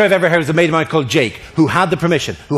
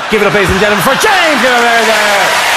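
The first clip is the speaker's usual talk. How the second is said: raised pitch, stretching out the name; announcer's call; 4 semitones higher, 4 dB louder than usual. Real, not acted. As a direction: rouse the crowd to applause. Host calls for applause, stretched name.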